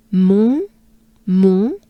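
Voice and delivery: "Mon" with closed nasal vowel; French nasal on. Shown as a demonstration. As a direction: model French "Mon", rising. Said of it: "very French sound".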